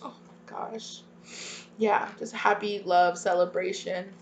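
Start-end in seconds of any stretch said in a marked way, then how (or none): 0.0-1.0 breathy hushed oh my gosh; awed whisper. Real, not acted. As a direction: barely voice it, overwhelmed. Awed reaction after long pause.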